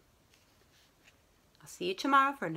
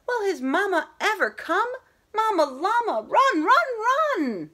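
much higher, loud urgent calling; hammed-up panicked cry; 10 semitones higher, 6 dB louder, slower than usual. Teacher playing the panicking baby llama at the story's peak, hammed-up high loud cry. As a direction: cry out for mama, run run run.

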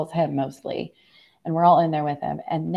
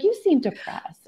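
mock oncologist voice; 12 semitones higher than usual. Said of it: "she's like".

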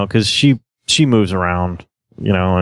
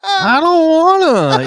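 whiny; 4 dB louder, slower than usual. Retold or imitated. Dad retells his own whining.

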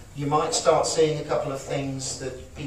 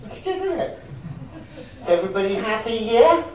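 high, bright-eyed cheer; cheerful demo; 5 semitones higher than usual. Shown as a demonstration. Demonstrating cheerfulness to the audience, high and cheerful. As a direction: beam at everyone, much higher.

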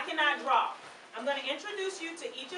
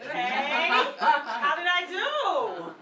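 louder, slow laughing question; 6 dB louder, much slower than usual. Real, not acted. Teacher laughing about her bad drawing after a child's jab. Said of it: "This is so bad".

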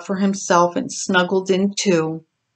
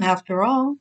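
plain reading voice

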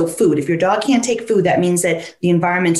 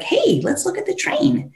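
bright invitation; 11 semitones higher than usual. Retold or imitated. Owner cheerfully calling dog's attention.

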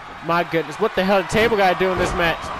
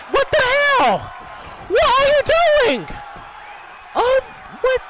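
high-pitched outraged shout; exasperated yell; 14 semitones higher than usual. Real, not acted. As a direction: holler in disbelief at the screen. Yelling at wrestlers on screen.

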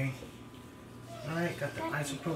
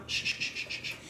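soothing shush; 7 dB quieter, faster than usual. Real, not acted. Soothing the dog.

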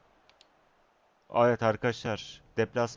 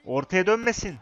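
urgent shout; 8 semitones higher, 7 dB louder than usual. Real, not acted. Urging player during match.

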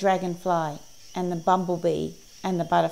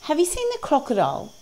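high-pitched parrot voice; high parrot question, dropping at the end; inquiring parrot; 10 semitones higher, faster than usual. A narrator's reading. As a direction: ask it high, as a storybook parrot. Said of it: "asked the parrot".

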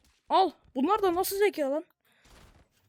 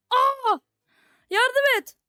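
loud, urgent cry for help; calling for help; 5 semitones higher, 4 dB louder, much slower than usual. Real, not acted. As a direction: shout for your buddy to jump in. Calls for help again. (yardım için bağırarak)

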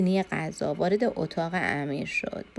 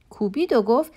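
usual speaking voice

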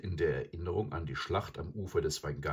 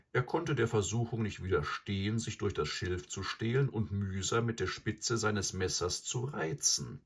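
plain reading voice

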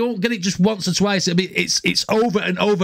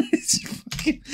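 usual speaking voice

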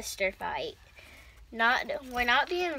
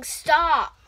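sharp loud stop, higher; yelling stop; 4 semitones higher, 11 dB louder than usual. Real, not acted. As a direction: bark it out loud. Sister yells at swinging brother.

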